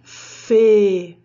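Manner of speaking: fê said closed and held, like ê. Shown as a demonstration. Teacher isolating the unaccented syllable of feliz, closed. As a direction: hold a closed ê in fê. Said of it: "o som do E".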